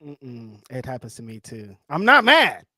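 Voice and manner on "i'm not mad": loud, high, playful outburst; mock annoyed protest. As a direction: protest as if pushed into being mad. Acts out getting mad when asked.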